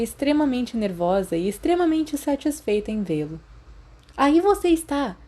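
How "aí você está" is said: high, excited call across the room; shouting. Acted. Lily finally finds Remus and shouts out, high and eager. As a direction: call out, relieved to find him. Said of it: "ela gritou".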